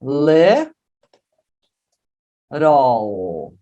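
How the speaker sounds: "Little" split as "le" ... "rol", with a long pause; syllables broken down. Shown as a demonstration. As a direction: stretch each half of "Little", ending on a vowel-like L. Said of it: "ele tem som de vogal". ("Little" separado em sílabas, com R e L vocálico)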